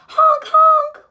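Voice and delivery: loud, high honking horns; car horns. A narrator's reading. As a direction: blare it out like a car horn.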